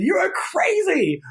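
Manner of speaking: high, slow laughing call of you are crazy; giggly playful shout. Retold or imitated. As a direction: tease her through giggles, loud and high. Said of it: "I started giggling so loud, and I said".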